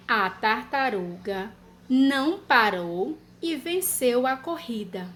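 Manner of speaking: slow, word by word dictation; dictation pace. Shown as a demonstration. Teacher dictating a sentence to children. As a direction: dictate it slowly so children can write. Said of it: "E última frase".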